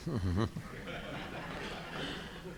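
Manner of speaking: low, quiet chuckle; a short run of soft, low laughs. Real, not acted. Moderator handing a question to a panelist, soft chuckle.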